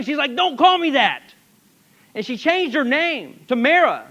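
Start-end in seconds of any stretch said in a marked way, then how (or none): 0.3-1.2 bitter rebuff. Acted. Bitter Naomi rebuffing the townspeople.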